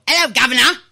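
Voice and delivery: a cartoon English gent, laid on thick; over-the-top British accent. Shown as a demonstration. Host mocking overdone accents, in a hammed-up British accent. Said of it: "you wanna go over the top".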